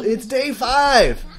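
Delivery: high, drawn-out cheer; excited announcement. Real, not acted. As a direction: sing out the news, stretching it high. Calls out the new game day.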